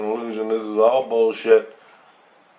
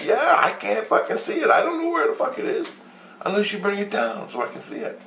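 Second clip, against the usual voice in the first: exasperated; 11 semitones higher than usual. Retold or imitated. Speaker's exasperated reply retold.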